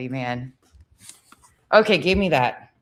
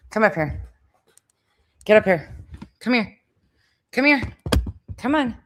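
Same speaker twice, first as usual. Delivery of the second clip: rising, louder calls to the dog; coaxing the dog up onto the couch, getting louder and higher; calling the dog; 6 semitones higher, 3 dB louder than usual. Real, not acted. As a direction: call him up, higher each time.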